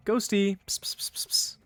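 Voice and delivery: high call, then hushed psst-psst; playful sing-out into whispered clicking; coaxing a pet. Pretend. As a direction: call the ghost like a cat. Calling ghost like a pet.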